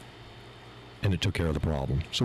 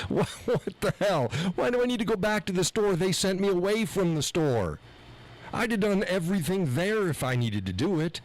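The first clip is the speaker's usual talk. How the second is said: higher, incredulous outburst about the runaround; exasperated rant; 9 semitones higher than usual. Real, not acted. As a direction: let the outrage spill out, climbing high. Venting fury at being sent back.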